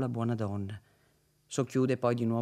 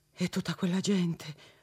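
trembling with fear; 5 semitones higher than usual. Acted. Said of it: "labbra contratte e tremanti di spavento".

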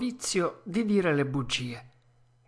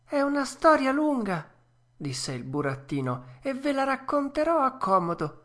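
Pinocchio, moved; 9 semitones higher, 3 dB louder than usual. A narrator's reading. Puppet thanking the fairy, high and tender.

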